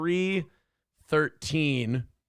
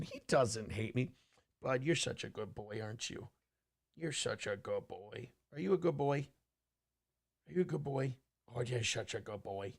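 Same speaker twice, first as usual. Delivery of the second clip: soft, lower cooing to the dog; pet talk; 6 semitones lower, 19 dB quieter than usual. Real, not acted.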